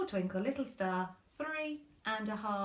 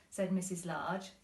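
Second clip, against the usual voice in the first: ordinary delivery, nothing marked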